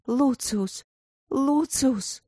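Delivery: high cry of a lover's name; acted cry of passion; passionate cry. A narrator's reading. Woman cries lover's name in passion.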